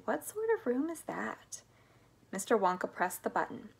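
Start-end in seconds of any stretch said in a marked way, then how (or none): 0.0-1.6 hushed, higher wondering; curious musing under the breath; puzzled thought. A narrator's reading. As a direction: wonder privately, half whispered. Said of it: "thought Charlie".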